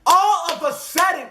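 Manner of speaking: sudden high dramatic restart; dramatic buildup. Retold or imitated. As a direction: jump up for the twist.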